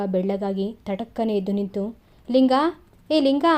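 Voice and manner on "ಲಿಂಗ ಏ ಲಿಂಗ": a raised call for Linga, higher and louder. A narrator's reading. Frightened master calls out for his missing servant. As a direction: call his name into the dark. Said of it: "ಅಂತ ಕೂಗಿದರು".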